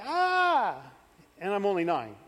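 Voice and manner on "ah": high re-enacted cry of pain; pained yell. Boy with his hand pinned under the bunk, high pained yell. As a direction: yelp as if your hand just got pinned. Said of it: "tremendous pain, and now I'm like".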